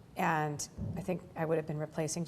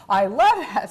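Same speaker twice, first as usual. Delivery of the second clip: high, loud imitated gushing; retold delight; 5 semitones higher, 13 dB louder, slower than usual. Star approving the speech idea, mock-delighted. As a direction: gush in imitation of a thrilled colleague. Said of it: "and she was like".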